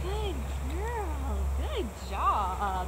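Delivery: high, soft sing-song praise; dog praise voice. Real, not acted. Trainer praising her dog Lucy, high soft sing-song.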